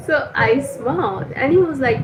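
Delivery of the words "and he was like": ordinary delivery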